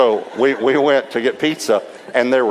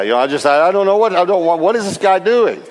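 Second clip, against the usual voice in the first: higher, louder exasperated rush; flustered outburst; 8 semitones higher, 4 dB louder than usual. Retold or imitated. Preacher reliving his panic at being questioned. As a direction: sputter in bewildered panic.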